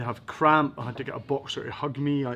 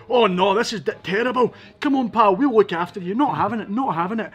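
higher and louder, mock outrage; loud protest; 6 semitones higher, 6 dB louder than usual. Defending the cameraman in the house, higher loud outrage. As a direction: play up rushing to defend him. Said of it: "And I'm like".

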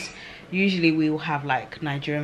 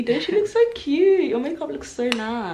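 cooing high compliment; cutesy high voice; 9 semitones higher, 4 dB louder than usual. Real, not acted. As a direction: gush over her, sweet and high. Complimenting friend.

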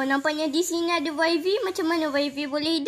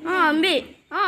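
usual speaking voice

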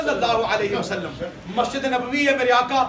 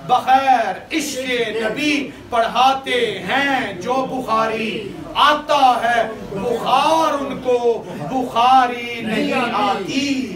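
very slow, drawn-out recitation; broken-up verse; much slower than usual. Shown as a demonstration. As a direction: break the couplet word by word, holding the endings. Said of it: "توڑ کے پڑھا ہے نا؟". (توڑ توڑ کر، کھینچ کر)

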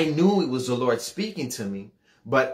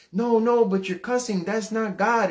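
high, agitated motherly scolding; objecting mother; 9 semitones higher than usual. Retold or imitated. Mom rebuking her cussing son, disapproving. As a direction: cut in with a raised-pitch objection.

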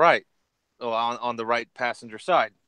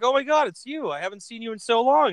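high-pitched mock delight; acted happy amazement; excited reunion; 11 semitones higher than usual. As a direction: greet a long-lost friend, pitched way up. Acting out reunion with his robber.

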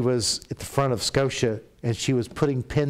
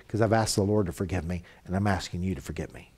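humble apology; 5 semitones lower, 4 dB quieter, faster than usual. Shown as a demonstration. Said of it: "Why are you asking me to forgive".

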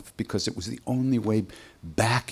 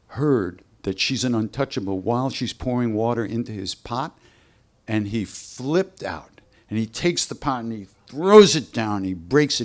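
ordinary talk, nothing marked

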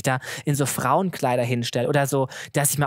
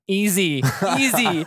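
laughing; 3 semitones higher, much slower than usual. Retold or imitated. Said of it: "Und ich war so".

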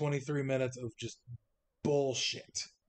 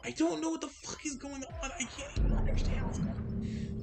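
panicked; 12 semitones higher than usual. Real, not acted. Panicking, lost in the game.

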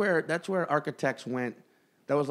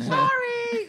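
high squeaky apology; 5 dB louder than usual. Retold or imitated. Imitating woman apologizing.

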